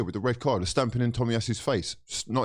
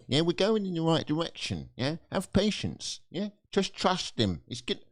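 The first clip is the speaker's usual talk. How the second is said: sarcastic mock; 3 semitones higher, 3 dB quieter than usual. Fan mocking those who say trust the manager, sarcastic. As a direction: sneer out the fans' advice. Mocking other fans' optimism.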